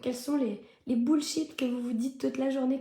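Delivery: ordinary talk, nothing marked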